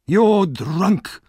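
high, loud sneer. Acted. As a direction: sneer at her.

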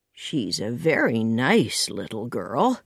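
slow, low man's voice; slowly. A narrator's reading. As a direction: take your time, gently and low. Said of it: "said Matthew slowly".

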